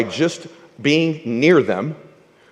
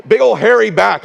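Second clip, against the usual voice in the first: normal talking voice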